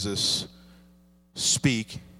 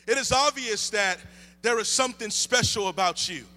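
higher, lifted preaching voice; 9 semitones higher than usual. Acted. Pastor preaching to the church, lifted and higher. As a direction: preach it out to the congregation.

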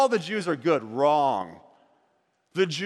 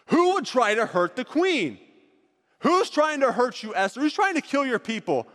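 higher, lightly raised outrage; furious king; 6 semitones higher than usual. Acted. King demanding who threatens the queen, mild outrage. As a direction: demand answers, mildly indignant. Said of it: "is furious".

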